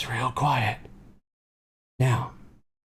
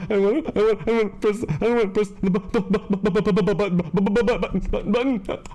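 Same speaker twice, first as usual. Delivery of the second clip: higher, stuck stuttering in panic; panicked stammer; 5 semitones higher than usual. Acted. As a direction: stammer as if too scared to finish. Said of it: "I'm s-, scared".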